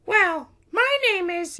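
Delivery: very high, slow, loud princess voice; princess introducing herself. A narrator's reading. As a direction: begin proudly as a little princess.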